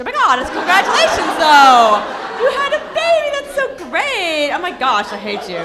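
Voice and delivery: high, loud gushing squeal; fake congratulations. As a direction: overdo the fake delight.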